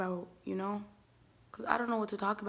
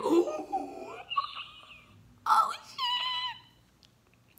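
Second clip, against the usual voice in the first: very high, thin mock squeal; drawn-out falsetto reaction; shocked squeal. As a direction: squeak it out, far above normal pitch. Reacts to mom siding against her.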